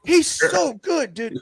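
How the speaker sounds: squealing fan praise, drawn out slowly; excited gushing. Real, not acted. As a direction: rave about him, voice jumping way up. Gushing over wrestler Dragon Lee.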